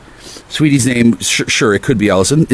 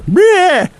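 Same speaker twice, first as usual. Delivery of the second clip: high squawked bleh; mock bleh. Mocking his own radio rant with a high bleh. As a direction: spit out a silly high bleh.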